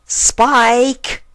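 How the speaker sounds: loud high call of his name from bed; sick unicorn calls her assistant upstairs, loud and high. Acted. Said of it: "she called for her number one assistant".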